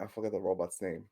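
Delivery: ordinary delivery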